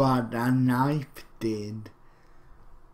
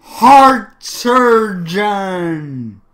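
dramatic slow read; 6 semitones higher, 10 dB louder, much slower than usual. Pretend. Reading title dramatically.

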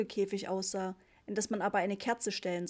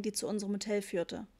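usual speaking voice

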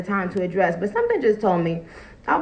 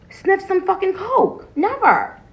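emphatic denial; 10 semitones higher than usual. Real, not acted. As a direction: shoot up high and hit never hard. Denying drug rumours.

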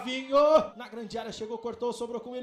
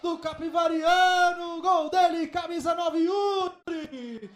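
goal shout; 3 semitones higher, 5 dB louder than usual. Real, not acted. Fourth goal.

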